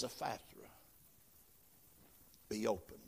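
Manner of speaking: soft and low, almost breathed out; hushed words of Jesus. Acted. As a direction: speak the healing word gently, under your breath. Jesus heals the deaf boy.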